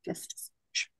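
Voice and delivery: breathy whisper, barely voiced. Shown as a demonstration. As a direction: whisper it as a sly aside. Repeats the phrase under her breath.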